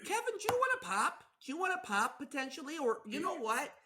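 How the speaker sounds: very high, chirpy Midwestern offer; hammed-up high Midwest hospitality; Midwest accent. Spouse offers Kevin a pop, high Midwest accent. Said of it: "It's the best accent".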